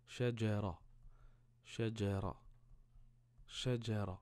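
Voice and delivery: shajara with rolled r, open a vowels; word reading. Shown as a demonstration. Teacher reading the finished word to a pupil. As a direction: read shajara clearly and firmly. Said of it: "Et là, ra".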